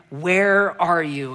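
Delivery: drawn-out, slow "Where are you?" in a calling voice. Acted. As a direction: call it out slowly, as if searching. God calling to the man.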